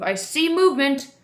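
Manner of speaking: usual speaking voice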